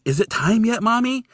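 put-on child's eager voice. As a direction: ask like an impatient kid. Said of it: "she's like".

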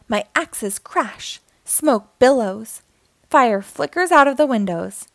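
usual speaking voice

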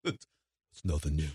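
very low, soft, slow Rambo growl; mock Rambo. Echoing the Rambo line to the co-host, very low and slow. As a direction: drag it out low like a burnt-out soldier.

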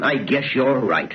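ordinary talk

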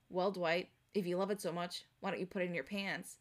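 low, soft deadpan delivery; underplay it like dry office comedy; dry, quiet, dropped-pitch line. Acted. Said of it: "in a deadpan comedy".

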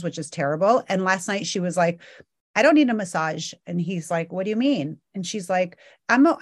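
ordinary delivery

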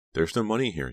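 earnest, high insistence; insistent. A narrator's reading. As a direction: insist on it, voice lifted. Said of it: "Dina insisted".

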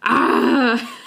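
a loud ah half-laughing in frustration; frustrated ah. Shown as a demonstration. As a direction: blurt ah like damn it. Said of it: "It's like, damn it".

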